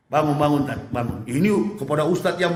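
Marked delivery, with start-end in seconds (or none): 0.1-1.2 soft, low, coaxing wake-up; tender angel voice. Acted. Angel gently waking the preacher himself, tenderly soft. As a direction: gently rouse a friend from sleep. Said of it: "lembut sekali".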